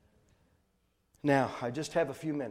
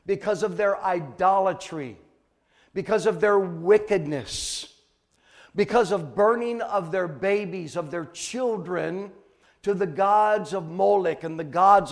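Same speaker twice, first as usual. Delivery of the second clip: forceful, loud sermon delivery; preach; 4 semitones higher, 8 dB louder than usual. Acted. Preacher declaring God's word to the congregation, forceful preaching. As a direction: preach it out with hard, loud emphasis on key words.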